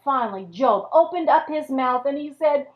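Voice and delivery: usual speaking voice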